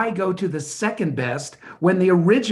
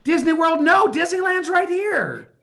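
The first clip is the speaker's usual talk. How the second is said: yelling; 10 semitones higher than usual. Acted. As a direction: yell it at top speed. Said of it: "Don't yell!".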